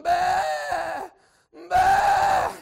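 loud, high imitated bleating; mother sheep bleat. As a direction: bleat like a frantic ewe. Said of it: "she come running right by me going".

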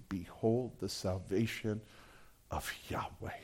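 near whisper, fading out; whispered awe. Acted. Preacher repeats Moses' words to the congregation, whispered. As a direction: breathe the words in wonder. Quote repeated at the sermon's climax.

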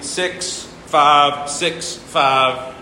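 "six five six five" drawn out very slowly; slow demonstration. Shown as a demonstration. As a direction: lay out each number of the meter slowly. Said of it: "you'd sing".

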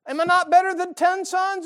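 high, loud mock bewildered husband; husband pesters his crying wife, high and loud; exasperated husband. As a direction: fire off the questions like a clueless husband. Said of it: "And he's going".

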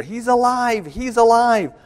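He's alive shouted high and loud; retold yell. Diver shouting up to the boat, a high excited yell. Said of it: "Nico begins yelling".

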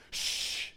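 sharp whispered shh to silence the room; hushing whisper. Shown as a demonstration. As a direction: hush them all at once. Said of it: "The effect is this".

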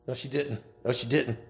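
ordinary delivery

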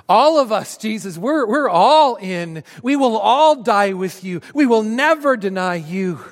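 loud, high, hearty all-in pledge; rally everyone to the cause; rallying vow. Acted. Disciples pledging to Jesus together, loud rallying vow.

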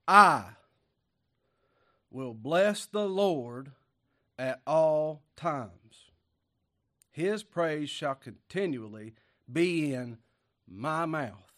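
very slow, loud, stretched-out proclamation; preaching at full force. Real, not acted. Pastor reading verse one to his church, slow and loud. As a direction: drag out every word like a shout from the pulpit.

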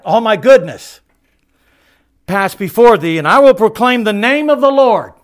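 high, loud, ringing proclamation; booming voice of God. Acted. Preacher voicing God to Moses, booming and high. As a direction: thunder it out as God speaking.

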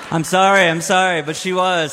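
apologizing with a grin, half joking; laughing apology. Real, not acted.

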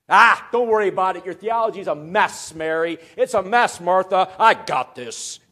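loud, dismissive take-charge delivery; hammed-up "leave it to me" bravado; mock breezy reassurance. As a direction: brush their worries aside at full voice. Acts out Jesus brushing off grief.

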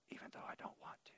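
whispered, quick and grudging; a fast sulky whisper. Retold or imitated. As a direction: mutter it under your breath as a reluctant confession. Grudging aside inside quoted reply.

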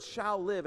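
single out "shall live" as the key words; stress on shall live. Shown as a demonstration. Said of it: "The emphasis on the word".

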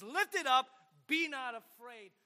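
loud, ringing preacher's call; strong voice. Acted. Preacher urging the men to proclaim, loud. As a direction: proclaim it boldly to the congregation. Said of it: "proclaiming the good news with a strong voice".

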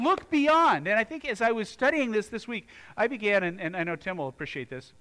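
ordinary delivery

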